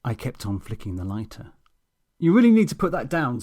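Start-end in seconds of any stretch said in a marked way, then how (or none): 2.2-3.4 fast, loud stern order; officious demand. Acted. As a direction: insist firmly. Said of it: "demanded Nigel".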